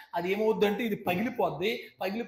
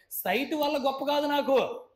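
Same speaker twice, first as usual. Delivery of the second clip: preacher's outcry; 9 semitones higher, 6 dB louder than usual. Acted. Preacher declares God's glory.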